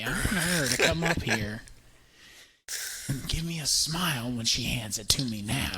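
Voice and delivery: lightly voiced creepy Joker. Barista mimicking the customer as a light Joker. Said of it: "like it's a Joker talking to me".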